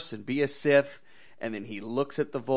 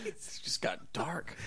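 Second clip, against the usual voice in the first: whispered, slow aside; hushed reaction; 5 semitones higher, 10 dB quieter, slower than usual. Real, not acted. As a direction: whisper it after the dark joke. Reacts to a dark joke.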